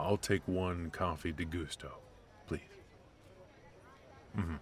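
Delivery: usual speaking voice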